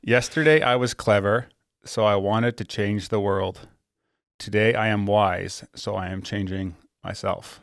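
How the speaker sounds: slow, deliberate, every word spelled out; over enunciating. Shown as a demonstration. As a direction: read it slowly for slow listeners. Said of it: "Say it one more time slowly".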